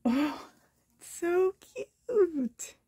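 choked up and tearful, slow and high; real tears catching the words; voice breaking. Real, not acted. Opening Erin's gift, voice breaking with emotion. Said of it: "sorry about my voice".

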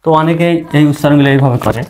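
ordinary talk, nothing marked

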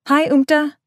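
put-on girl greeting a returning friend; friendly girl voice. A narrator's reading. May greets Umta.

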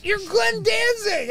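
high, slow, loud incredulous shout; can't believe it's him; astonished fan shout; stunned disbelief. Retold or imitated. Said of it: "I go".

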